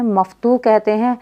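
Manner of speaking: normal talking voice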